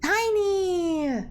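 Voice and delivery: high squeal of delight. Real, not acted. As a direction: squeal the word like it's adorable. Delighted by tiny tiefling.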